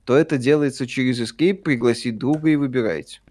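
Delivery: ordinary talk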